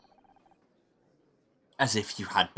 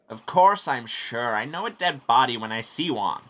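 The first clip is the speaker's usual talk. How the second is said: loud, higher, emphatic retort; agitated insistence; 3 semitones higher, 9 dB louder than usual. Acted. Witness answering doubts about the dead body, agitated insistence. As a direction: insist on it loudly, rattled.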